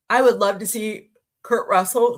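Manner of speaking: words stretched through a real yawn. Real, not acted. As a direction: talk while yawning. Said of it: "I yawn when I get to, like, jabbering".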